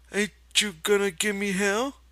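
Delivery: put-on high, childlike confession; Lenny confessing. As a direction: admit it like a guilty child. Lenny asking.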